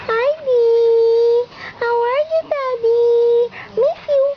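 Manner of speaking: put-on high, cooing little-child voice; baby voice. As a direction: speak as the baby to Daddy. Mother voices baby for Daddy.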